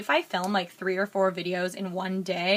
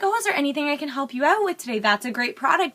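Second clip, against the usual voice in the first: bubbly salesgirl; 5 semitones higher than usual. Retold or imitated. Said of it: "super upbeat and bubbly".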